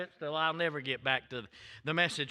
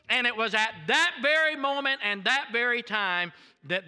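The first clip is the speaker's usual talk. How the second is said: raised, fervent testimony; preaching; 8 semitones higher, 4 dB louder than usual. Acted. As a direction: preach it with building fervor. Preaching testimony at full force.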